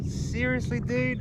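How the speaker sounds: high-pitched exasperated disbelief; mock incredulous. Rider reacting to passing Harleys, mock incredulous. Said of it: "I'd be going".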